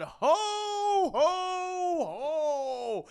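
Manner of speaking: jolly, high-starting ho-ho-ho; Santa. Acted. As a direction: let each ho ring out, stepping down. Santa arrives after sleigh bells.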